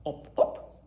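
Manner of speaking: whispered frog call. A narrator's reading. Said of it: "replied the Thai frog".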